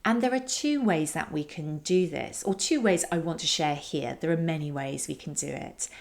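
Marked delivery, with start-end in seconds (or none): none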